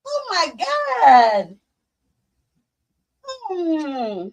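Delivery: ordinary talk, nothing marked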